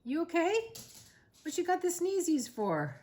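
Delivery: high sing-song pet voice for the sneezing dog. Real, not acted. Talking to her sneezing dog.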